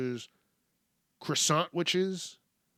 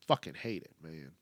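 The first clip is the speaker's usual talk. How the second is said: "Fucking hate it" said low and softer, under the breath; muttered; 11 semitones lower, 9 dB quieter than usual. Real, not acted. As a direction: grumble "hate it" quietly to yourself. Muttered then shouted repeat.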